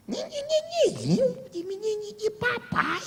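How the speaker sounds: tatibitate muito agudo e arrastado; voz infantil. Retold or imitated. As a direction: mimando como quem fala com bebê, bem agudo e lento. Imita tratamento infantil. (vozinha de bebê muito aguda e lenta)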